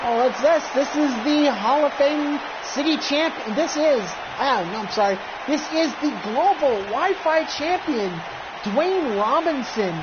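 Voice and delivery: wrestling announcer. Acted. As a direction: hype ringside announcer call. Ringside announcer introduces champion.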